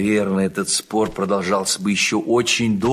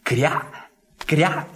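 duckling voice; 7 semitones higher, slower than usual. Acted. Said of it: "возразил утёнок Крячик".

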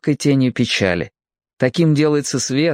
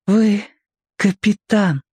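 put-on hushed boy's voice; 10 semitones higher, slower than usual. A narrator's reading. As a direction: voice an awed boy, pitched well up. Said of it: "Он не хотел говорить громко". (негромко, голосом мальчика)

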